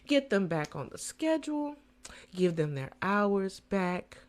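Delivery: normal talking voice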